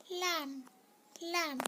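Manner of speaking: लैम्प said as "lamp" with the b unheard; silent b in lamb. Shown as a demonstration. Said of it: "देखिए, ये जो M है ना".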